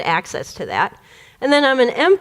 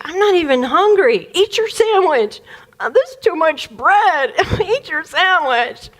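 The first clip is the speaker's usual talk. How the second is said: mocking whine; 6 semitones higher, slower than usual. Child mimics dad while stalling.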